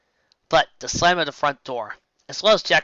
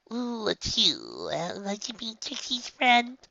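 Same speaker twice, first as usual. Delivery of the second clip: soft, higher, slow little voice; shy hopeful filly; 9 semitones higher, 6 dB quieter, slower than usual. Acted. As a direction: ask timidly, hoping for a yes. Filly shyly asking for friendship.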